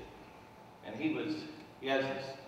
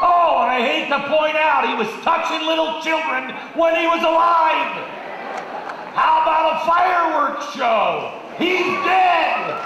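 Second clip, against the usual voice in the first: angry shouting rant; 6 semitones higher, 6 dB louder than usual. Retold or imitated. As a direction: shout it, higher and louder, exasperated. Grandfather's voice rant continues.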